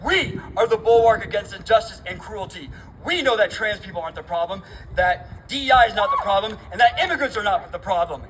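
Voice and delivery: normal talking voice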